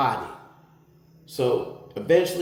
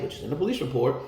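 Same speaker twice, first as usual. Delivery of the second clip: ordinary delivery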